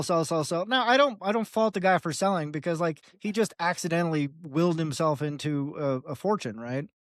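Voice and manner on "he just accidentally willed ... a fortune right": ordinary delivery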